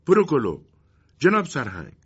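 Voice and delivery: calling out from behind. Acted. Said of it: "یک نفر از پشت سر او صدایش کرد".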